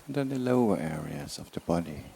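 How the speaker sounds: slow, measured meditation guidance with long silences; unhurried body-scan voice, words drawn out; guided meditation. Real, not acted. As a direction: ease them slowly into relaxation, phrase by phrase. Leads a body relaxation meditation.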